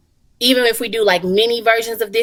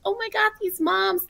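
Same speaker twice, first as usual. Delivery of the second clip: touched and excited; 11 semitones higher, 6 dB quieter than usual. Retold or imitated. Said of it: "because I was like".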